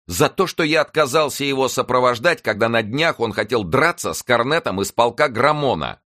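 high, loud self-defence; indignant protest. A narrator's reading. Lackey explaining the accusation against him, indignant protest. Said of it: "воскликнул Оливен".

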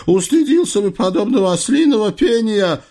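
high-pitched exasperated outburst; snapping. Acted. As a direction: snap at him, losing patience. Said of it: "сорвался Насреддин".

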